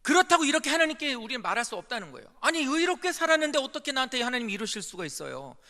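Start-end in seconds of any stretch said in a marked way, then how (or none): 2.4-5.6 loud, high indignant protest; shouting accusation at God, a bitter complaint; acted complaint to God. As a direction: rail at God as if wronged. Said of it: "원망을 하고 죽어버리라는 거예요".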